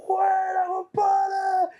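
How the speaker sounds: high yelled greeting from across the street; street shout. Retold or imitated. Man in the street yelling at the TV contestant, high shout. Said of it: "me gritaba en la calle".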